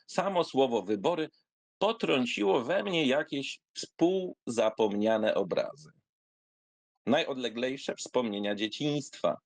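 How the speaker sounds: usual speaking voice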